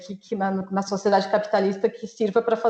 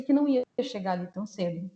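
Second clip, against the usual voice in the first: ordinary delivery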